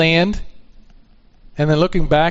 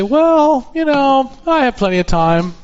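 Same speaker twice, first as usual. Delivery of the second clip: mimicking a complacent excuse, pitched high; casual procrastinator; 9 semitones higher than usual. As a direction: put on a higher, brush-it-off voice. Mimics someone putting off salvation.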